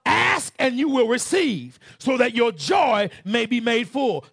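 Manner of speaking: loud, high revival preaching; fiery pulpit proclamation; preaching at full force. Acted. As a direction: thunder the scripture out to the church.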